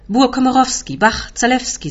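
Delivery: high, emphatic roll-call of names; loud outburst. A narrator's reading. Uncle calls out names, excited.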